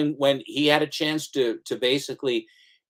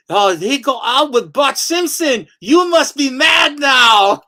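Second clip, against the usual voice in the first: high, slow, loud hammed-up taunt; mock propaganda voice; 10 semitones higher, 7 dB louder, slower than usual. Mocking the enemy broadcast for the listener, loud. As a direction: shout the taunt in a silly put-on voice.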